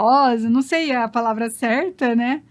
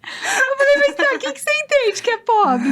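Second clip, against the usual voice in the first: laughing high while talking; laughing while retelling; 12 semitones higher, 4 dB louder than usual. Real, not acted. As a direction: speak through real giggles. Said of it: "Comecei a rir".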